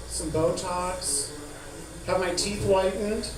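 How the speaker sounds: normal talking voice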